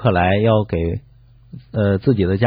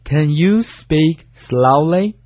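higher-pitched, slow repeat for learners; slow model reading; 5 semitones higher, much slower than usual. Shown as a demonstration. Co-host repeats the teacher's sentence for listeners, slowly. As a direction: stretch each word out for learners.

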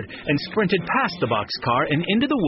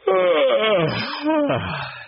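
long yawn starting high and falling away; loud yawn; 12 semitones higher than usual. A narrator's reading. Eddie finishes his coffee before bed, loud yawn. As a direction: yawn big and drawn-out. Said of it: "He yawned loudly".

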